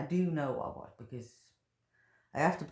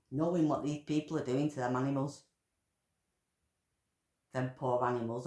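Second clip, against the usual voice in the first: ordinary delivery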